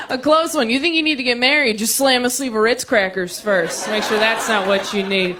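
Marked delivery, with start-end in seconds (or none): none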